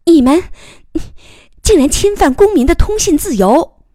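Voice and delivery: high, loud indignant outburst; sudden anger. A narrator's reading. Young reporter accusing the officer of snooping, furious. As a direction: flare up and protest at full voice. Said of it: "怒气顿时冲塞胸臆".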